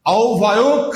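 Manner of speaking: আও ভায়ক ending in closed "ok"; rising model of "au-bhayok"; correct pronunciation. Shown as a demonstration. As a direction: say au-bhayok with rounded o. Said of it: "ওক উচ্চারণ হবে".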